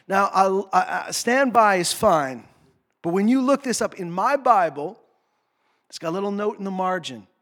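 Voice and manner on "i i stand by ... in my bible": usual speaking voice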